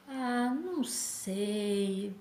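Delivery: slow, drawn-out doubtful reply; reluctant, slow "não sei"; hesitant. Retold or imitated. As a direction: stall on "Ah, não sei" as if unsure. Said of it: "Eu fiquei muito em dúvida".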